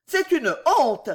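high-voiced maid's reproach; reproachful. A narrator's reading. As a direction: scold on the mistress's behalf. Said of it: "dit la jeune fille d'un ton de reproche". (ton de reproche, voix aiguë de jeune fille)

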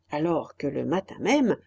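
ordinary delivery

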